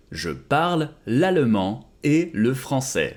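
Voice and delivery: slow it down for learners; slow model repeat. Shown as a demonstration. Teacher modelling French for students, slowly. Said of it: "slow repeat of model".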